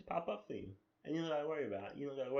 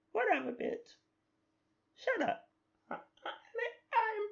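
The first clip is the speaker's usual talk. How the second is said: indignant, very high squeaky protest; high-pitched annoying voice; 5 dB louder than usual. Acted. Fake Lincoln defends himself to doubters, high annoying voice. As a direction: argue back in a piercingly high cartoon voice. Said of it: "that high pitch annoying voice".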